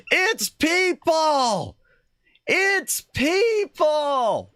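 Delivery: very high, slow, loud dramatic cry; Soylent Green yell. Acted. Quoting the movie about the witch stew, loud and slow. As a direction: wail it like the movie's famous line.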